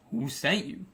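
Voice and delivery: lightly imitated menacing man; a hint of a big, scary man's voice. Angry homeowner confronting the prankster, mildly imitated scary voice. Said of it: "scary, like, voice".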